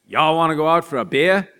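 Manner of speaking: put-on loud, high Southern-Boston accent mash-up; mixed-up accent. Back home inviting friends out, mixed Southern-Boston accent. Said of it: "had the most schizophrenic accent".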